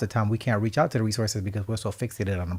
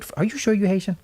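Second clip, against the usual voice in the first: incredulous quote; 9 semitones higher, faster than usual. Retold or imitated. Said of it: "When they look at me".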